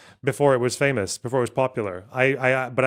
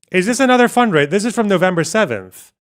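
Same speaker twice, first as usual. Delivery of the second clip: surprised; 7 semitones higher, 8 dB louder than usual. Real, not acted. Spots a new fundraiser date.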